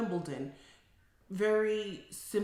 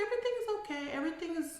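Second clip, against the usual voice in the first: high-pitched mimicry of a client; imitated client; 10 semitones higher, much faster than usual. Client reassuring her therapist, imitated in a high voice. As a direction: put on a high voice for the reply.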